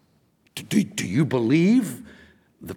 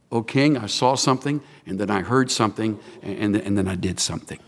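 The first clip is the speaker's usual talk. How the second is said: gentle, sensible testimony; 9 semitones lower than usual. Acted. Paul testifying before King Agrippa, gentle and low. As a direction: address a king gently and reasonably, voice dropping. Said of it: "delivered in the most gentle, sensible manner".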